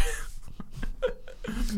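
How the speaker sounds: soft, breath-only laughing fit; wheezing laughter. Real, not acted. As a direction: laugh till the voice drops out. Laughs at ugly mush.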